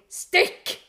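sharp shouted «stikk!» as a command; one loud, clipped word «stikk!»; exclamation. Shown as a demonstration. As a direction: bark «stikk!» like an exclamation mark. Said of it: "Alle hører at det er et utropstegn der".

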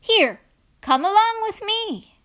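high, loud beckoning call; calling out invitation. A narrator's reading. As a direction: call someone over across the water. Jesus calls Peter over the water.

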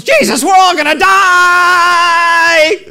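high, loud, slow panicked wail; panicked shout. Retold or imitated. Disciples in the storm crying out to Jesus, panicked and loud. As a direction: scream it like the boat is sinking.

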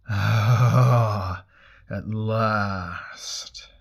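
loud, drawn-out god's sigh; great voice from fire. A narrator's reading. Burning god greets Elric from the fire, great booming voice. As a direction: stretch it out as a huge, hungry god. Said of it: "The voice came from the fire".

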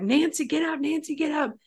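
high-pitched imitation of waking a sister; urgent waking call. Frightened girl wakes her sleeping sister, imitated high urgent plea. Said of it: "she's asking her sister".

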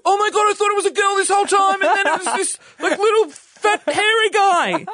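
high, loud rushed exclamation, slowing at the end; people's shocked reaction. Retold or imitated. People discovering the caller is no girl, mock shock. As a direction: mimic gobsmacked people in a high loud squeal. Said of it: "people go".

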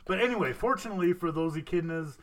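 normal talking voice

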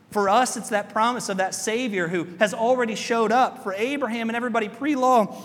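ordinary talk, nothing marked

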